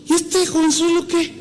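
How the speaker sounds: high, mocking voice of a disdainful elder; scornful old theologian. Proud theologian dismissing the nervous young preacher, scornfully. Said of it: "Así como si".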